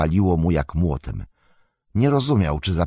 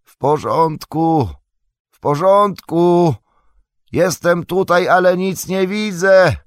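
slow, high, drawn-out calling; effortful high shout from below; strained distant call; 11 semitones higher, 5 dB louder than usual. A narrator's reading. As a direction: call up to someone far above. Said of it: "Frodo ledwie dobywał głosu".

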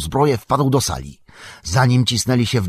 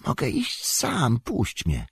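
much softer, weak plea; soft faint voice; 3 semitones higher, 7 dB quieter, slower than usual. A narrator's reading. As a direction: quietly insist you can walk. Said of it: "szepnął Frodo". (cichy, słaby głos)

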